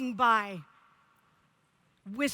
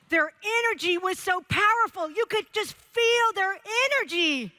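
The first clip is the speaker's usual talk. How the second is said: put-on louder, higher New York accent; lightly put-on New York accent, pitched up; 7 semitones higher, 5 dB louder than usual. Edward from New York tells what he saw in the boys, New York accent. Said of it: "with his New York accent".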